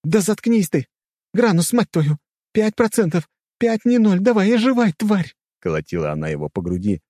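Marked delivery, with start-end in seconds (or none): 0.0-5.3 very high, loud frantic yelling; furious high-pitched outburst; desperate shouting. A narrator's reading. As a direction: yell as if forcing someone back to life. Heroine beating dying man to revive.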